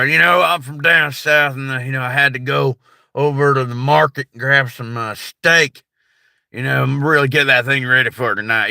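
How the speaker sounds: hammed-up American Southern accent. Shown as a demonstration. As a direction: play a good old boy from down south headed to the market. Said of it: "another English accent".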